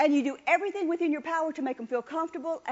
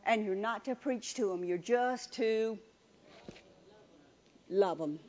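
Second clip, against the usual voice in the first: tender emphasis; 5 semitones lower, 4 dB quieter than usual. Acted. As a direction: lay love them down gently. Same softened close.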